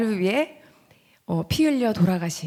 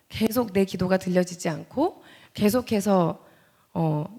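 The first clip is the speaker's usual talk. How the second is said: ordinary talk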